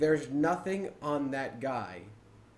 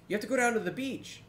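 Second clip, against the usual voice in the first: quick, higher-pitched quoted instruction; imitated Mumble player; 9 semitones higher, faster than usual. Player on Mumble passing on a tip, imitated higher voice. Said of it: "someone on Mumble be like".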